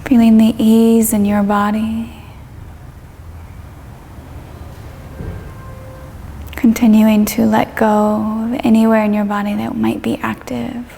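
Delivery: calm yoga teacher leading a meditation; guided meditation. Acted. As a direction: ease the listener down through the body, unhurried. Leads a guided body-scan meditation.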